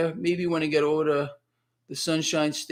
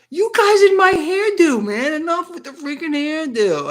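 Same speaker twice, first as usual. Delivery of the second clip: much higher, louder, slow hammed-up groan at the chat; mock exasperation; 12 semitones higher, 6 dB louder than usual. Host ribbing chat about his messy hair, mock exasperated. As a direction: play it up like you're fed up.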